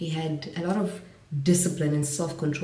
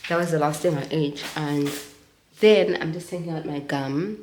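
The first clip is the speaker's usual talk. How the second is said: usual speaking voice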